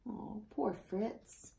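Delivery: soft, slow pity; sympathetic aside. A narrator's reading. Reader pities the pony for the listening children, soft and slow. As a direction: sigh it out for poor Fritz.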